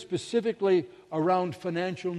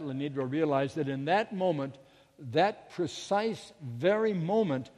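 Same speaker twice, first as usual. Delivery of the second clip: usual speaking voice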